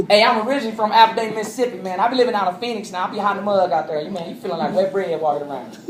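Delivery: fast, hammed-up Mississippi accent; Southern accent. Speaker jokes about Phoenix heat, Southern accent. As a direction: play a lively Southern country talker. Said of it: "I talked like this".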